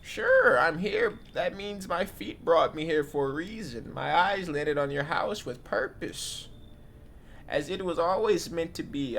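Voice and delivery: put-on high, slow old granny; grandma voice. Granny reassuring the host, high and slow. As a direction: coo like a cosy old granny. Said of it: "My grandma voice kinda mid".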